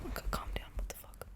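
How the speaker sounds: breathy hush under the breath; a real hushed aside to herself; whispered to herself. Real, not acted. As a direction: whisper it to yourself, reining in excitement. Calming herself mid-excitement.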